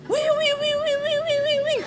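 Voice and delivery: high wailing siren imitation; alarm siren wail. Preacher imitates the hotel alarm going off, high siren wail. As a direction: wail it like an air raid alarm.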